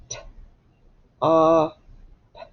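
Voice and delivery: "St-", "ah", "p-" as three isolated sounds with pauses; sounding out. Shown as a demonstration. Teacher sounding out the word stop, sound by sound. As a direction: break stop into blend "St-", vowel "ah", final puff "p-". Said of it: "you have a blend".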